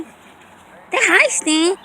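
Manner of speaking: high coaxing voice for the dog; high pet voice. Real, not acted. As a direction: coo at the puppy up high. Baby talk to her dog.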